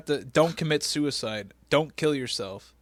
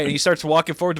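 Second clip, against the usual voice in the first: usual speaking voice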